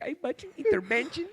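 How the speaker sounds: high, tearful mock plea; imitating crying mom. Son mimics mom crying about intervention, high tearful. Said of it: "She's like".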